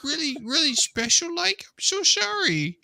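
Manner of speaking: very high simpering put-on voice; mock sweet talk. Husband indulging wife on phone, mock simpering. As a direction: simper it sky-high, insincerely.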